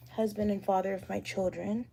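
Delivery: ordinary talk, nothing marked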